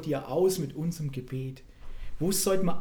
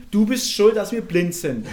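gespielter Vorwurf; 3 semitones higher, 7 dB louder than usual. Acted. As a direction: call out the blame in jest. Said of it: "riefen sie und sprachen". (gespielt vorwurfsvoll rufen)

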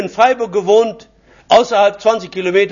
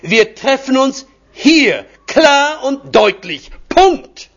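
slow and higher, building up to a loud final word; getting louder; 5 semitones higher, much slower than usual. Retold or imitated. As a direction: spell it out slowly, rising to a near shout. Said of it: "aber es wurde zunehmend lauter".

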